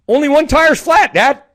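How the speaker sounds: put-on kid voice. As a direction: beam it like a happy little boy. Son voiced very high.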